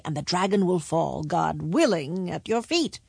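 usual speaking voice

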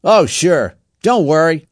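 brash leader reassuring loudly; gang leader. A narrator's reading. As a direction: voice a boy in charge, loudly. Gang leader's reply in story.

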